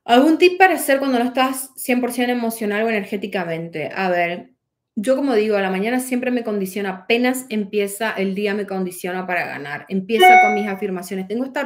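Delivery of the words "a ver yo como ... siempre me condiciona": usual speaking voice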